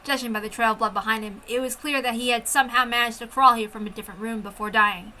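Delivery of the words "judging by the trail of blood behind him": ordinary delivery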